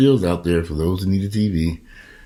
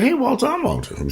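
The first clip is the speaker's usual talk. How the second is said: excited greeting. Retold or imitated. Meets cashier with same name.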